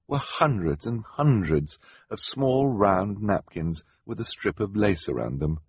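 plain reading voice

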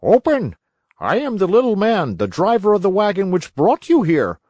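loud, high little man's call; calling through door. Acted. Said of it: "a voice called to them".

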